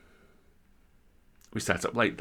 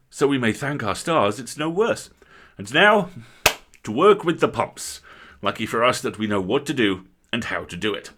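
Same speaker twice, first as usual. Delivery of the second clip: louder, hearty call to work; rallying squire; 3 semitones higher, 8 dB louder than usual. Acted. Squire sends the colliers to the pumps, hearty and loud. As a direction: stir the men to the pumps.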